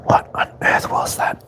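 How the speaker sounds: whisper. Real, not acted. Reader to herself, whispering in puzzlement. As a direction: whisper it to yourself, baffled.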